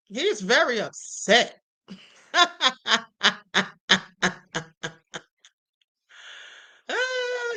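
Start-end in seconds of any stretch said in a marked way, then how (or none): 2.3-4.9 long staccato laugh; real drawn-out cackle at someone's rant. Real, not acted. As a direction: laugh in a string of separate bursts. Laughing at the upset man's rant.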